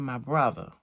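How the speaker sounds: ordinary talk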